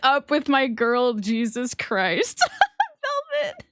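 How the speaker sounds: high squeal of shocked laughter; drawn-out exclamations breaking into laughter; laughing disbelief. Real, not acted. Streamer shocked by the steamy scene, laughing in disbelief.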